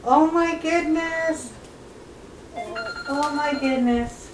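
high, slow drawn-out oh my goodness; amused awe. Real, not acted. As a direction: savor the cuteness slowly. Awed by puppies piling up.

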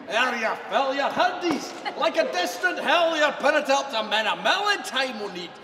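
usual speaking voice

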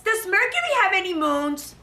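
put-on high, eager teacher voice for kids; high preschool teacher voice; bright teacher voice. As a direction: sing it up to the little ones. Teacher voice for children.

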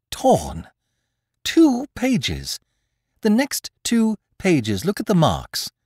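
high, loud and agitated, voice shaking; trembling with rage. Acted. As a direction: near shouting in anger and dismay. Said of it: "He trembled, shaking with rage and disappointment".

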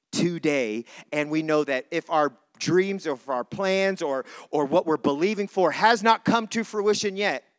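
ordinary delivery

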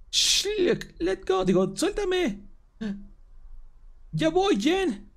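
a hint of a grabbed girl pleading; high girl voice. Grabbed girl telling Jen she's coming, lightly high-pitched.

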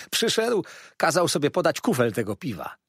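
high, lively waiter; storytelling waiter. A narrator's reading. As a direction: tell the alarming tale with gusto. Waiter tells his tale.

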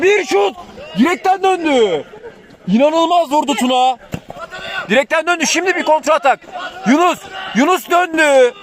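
screaming football commentator. Acted. Shot off the post, counterattack.

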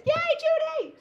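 very high, loud happy shriek; super excited. Real, not acted. Host cheers viewer Judy by name, squealing. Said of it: "now I'm super excited".

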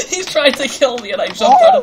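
high, loud laughing through words. Real, not acted. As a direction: laugh hard while talking. Laughing while fleeing.

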